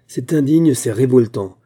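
a loud, indignant outburst at his wife; angry cry. A narrator's reading. Husband shouting his outrage at his wife's slander, loud and indignant. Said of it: "cria-t-il".

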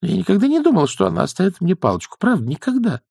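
high, quick protest; protesting young witch. Acted. Young witch defends herself against accusation, protesting high. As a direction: insist in a rushed high voice.

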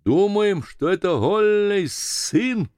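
high call, stretched at the end; answer from afar. A narrator's reading. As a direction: shout back slowly across the water. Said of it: "послышался ответ". (протяжный высокий ответ издали)

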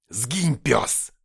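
loud curt bark; harsh barking commands. A narrator's reading. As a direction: bark the order like a dog. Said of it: "рявкнул Нефилим".

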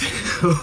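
a short loose giggle; giggle, dazed and pleased; drugged giggle. Acted. Drugged suspect giggles while confessing to the cops, loose.

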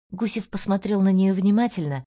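ordinary delivery, nothing marked

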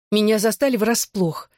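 ordinary delivery, nothing marked

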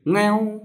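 high drawn-out mewing; cat meow. Acted. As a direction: mew like a cat. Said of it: "Con mèo vươn cổ kêu".

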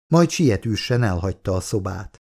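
plain reading voice

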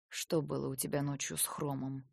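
low, soft, restrained question; hushed low interrogation; quiet questioning. A narrator's reading. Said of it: "Так же негромко спросил он".

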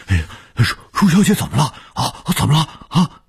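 high, flustered, stammering questions; urgent, anxious. A narrator's reading. Old man rushing in to ask what happened, urgent. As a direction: fire off worried questions in a high voice. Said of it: "急切地说".

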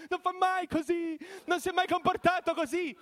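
very high wailing, shouting; crying owner. Retold or imitated. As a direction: wail it like a panicked owner. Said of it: "urlando e piangendo".

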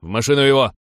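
gruffly snapped command; barked order. A narrator's reading. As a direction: bark it to your men. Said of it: "рявкнул голос".

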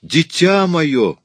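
very high, loud, long-drawn cry; exclaiming. A narrator's reading. Mother cries out again to her child, ecstatic exclamation. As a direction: wail it out to the child. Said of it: "воскликнула мадам Икс".

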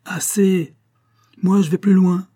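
exclaiming. A narrator's reading. Pécuchet cutting off Bouvard, exclaiming high and loud. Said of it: "s'écria Pécuchet".

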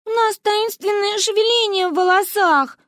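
put-on high childish confession; girls in chorus. A narrator's reading. Two girls confessing their secret in chorus. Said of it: "хором выдали мы".

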